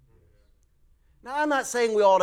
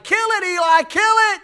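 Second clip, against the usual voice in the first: high, loud frantic shout; panicked yell; 12 dB louder than usual. Retold or imitated. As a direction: yell it from on top of the couch. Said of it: "and I just like".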